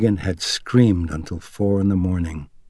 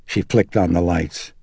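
ordinary delivery

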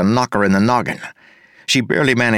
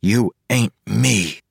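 growled; 2 semitones higher, slower than usual. A narrator's reading. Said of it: "Wayne growled".

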